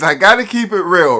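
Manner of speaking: loud, high-pitched insistence; emphatic declaration. Real, not acted. Emphatic declaration, then repeated softly.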